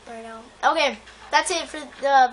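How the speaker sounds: normal talking voice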